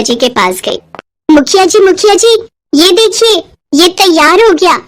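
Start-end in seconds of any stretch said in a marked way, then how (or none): 1.3-4.8 higher, excited calling out. Acted. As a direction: call out eagerly to show him. Girl runs in with invention. (खुशी से पुकारती हुई, ऊँची आवाज़ में)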